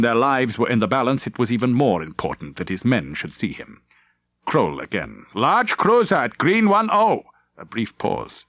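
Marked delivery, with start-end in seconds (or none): 5.3-7.2 urgent report. Acted. Officer reports enemy in battle.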